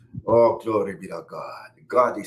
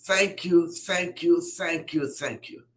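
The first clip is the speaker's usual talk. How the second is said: fading praise; 8 semitones higher, slower than usual. Real, not acted. Praise rising then fading.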